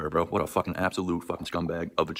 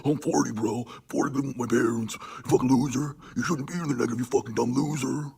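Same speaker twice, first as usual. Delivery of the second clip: higher put-on whiny voice mimicking the rival, slowing on the insults; mocking imitation; 7 semitones higher than usual.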